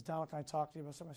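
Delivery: fast, hushed ask; quietly imitating a nervous friend; soft rushed request.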